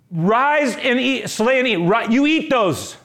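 loud, high commanding call; booming divine command. Acted. God commanding Peter, loud and high. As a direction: thunder it down as God's command.

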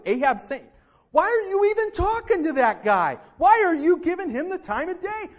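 loud, high, furious scolding; angry rebuke. Acted. Preacher voicing Elijah scolding Obadiah, angry shout. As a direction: chew him out like a betrayed prophet. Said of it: "could have gotten really mad at Obadiah and said".

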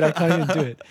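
usual speaking voice

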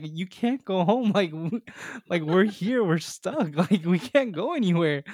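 put-on older camper pleading with the panicking boy, flustered; flustered reassurance. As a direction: talk him down while half-panicking. Calming panicked kid in cabin.

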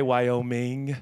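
Wyoming slowly, with a full "ming"; ING ending pronounced. Shown as a demonstration. Preacher settling how to say the state, Wyoming with clear "-ming". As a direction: stretch Wyoming out to its final -ing. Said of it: "It ends with an I N G".

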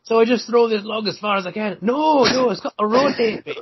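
high, hammed-up Scottish accent. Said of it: "my horrendous Scottish accent".

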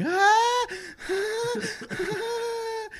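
very high squealing ah ah ah, fading out; mock girlish scream. Retelling the dog bite to friends, with a very high mock scream. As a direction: shriek like a terrified little girl. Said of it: "I scream like a little girl".

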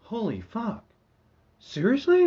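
high, loud astonished; amazed Martian. Retold or imitated. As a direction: gasp it out. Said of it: "they're like".

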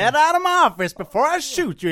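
high, loud cowboy threat; mock Texan threat. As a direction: run him out of your office. Texan doctor throws patient out.

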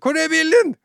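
frantic high yell, acting it out; retold shout. Driver yelling to his passenger about the car, shouted. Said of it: "og ropte til han".

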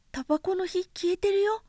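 put-on light, high boyish voice, curious; a small boy asking innocently; little prince voice. A narrator's reading. Little prince asking the vain man, high childlike voice.

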